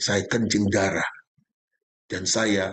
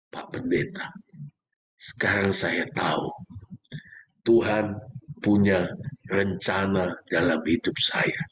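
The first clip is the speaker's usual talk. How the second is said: tearful confession; 4 semitones lower than usual. Retold or imitated. Man on the phone telling the pastor he now understands, tearfully. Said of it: "dia menangis".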